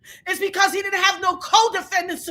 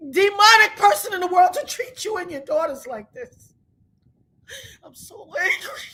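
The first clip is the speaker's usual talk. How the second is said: ordinary talk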